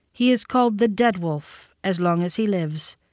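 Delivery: ordinary delivery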